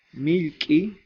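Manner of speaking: milqui said high and loud, rising at the end; modelled word. Shown as a demonstration. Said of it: "teacher modelling vocabulary". (milqui, alto y fuerte)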